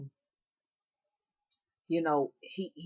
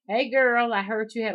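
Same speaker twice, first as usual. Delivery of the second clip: put-on loud hey girl; 6 semitones higher, 7 dB louder than usual. Calling loudly to a neighbour girl across the street.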